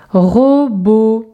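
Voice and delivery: "robot" in two long syllables; drawn-out teacher's demonstration. Shown as a demonstration. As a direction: stretch each syllable for young readers. Syllable exercise, picture word.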